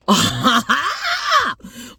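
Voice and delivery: loud, high theatrical gasp; shocked gasp. Acted. Storyteller gasps at the boy's name, shocked. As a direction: gasp big in mock shock.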